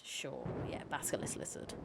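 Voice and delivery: soft, nearly whispered muttering to oneself; mumbled aside. Real, not acted. Reader unsure of a Latin lizard name, mumbled aside. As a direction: drop to a low-volume, voiceless mumble.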